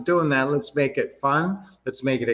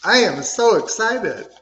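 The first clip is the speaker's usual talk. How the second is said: higher and louder, a burst of pumped-up energy; excited affirmation; 7 semitones higher, 4 dB louder than usual. Shown as a demonstration. Coach leading the group affirmation, pumped and excited. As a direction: shout it like you're fired up for the call. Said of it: "I want you to do a clap and a rub".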